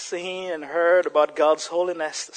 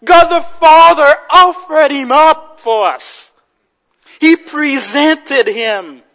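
loud, high, emphatic sermon delivery; full-force preaching; 9 semitones higher, 12 dB louder than usual. Real, not acted. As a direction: proclaim it forcefully to the congregation.

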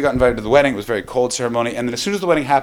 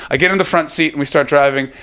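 usual speaking voice

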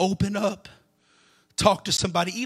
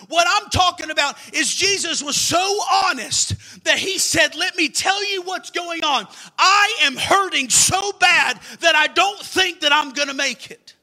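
fervent preaching; 6 semitones higher, 4 dB louder than usual. Acted. Preacher at full force.

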